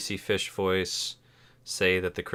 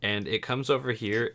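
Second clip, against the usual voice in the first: usual speaking voice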